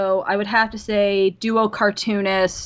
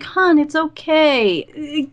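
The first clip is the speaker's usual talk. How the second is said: soothing; 6 semitones higher, 4 dB louder, much slower than usual. Retold or imitated. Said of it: "it's just like".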